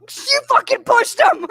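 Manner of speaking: high, loud burst of shocked realisation; scandalised outburst on the edge of laughter; retold shock. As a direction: blurt the accusation high and loud.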